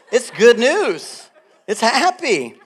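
laughing as you savour every word; delighted laughing emphasis. Real, not acted. Preacher to congregation, slow and laughing with delight. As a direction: stretch out good news, high and beaming.

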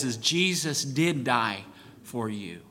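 quiet, slow and personal; soft appeal. Real, not acted. Preacher pleading softly with each listener. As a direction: drop it low, close to them.

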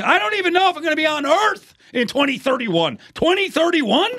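very high-pitched disbelief; incredulous outburst. Real, not acted. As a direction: exclaim it as if the date is absurd. Incredulous at the distant arena date.

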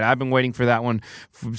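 ordinary delivery

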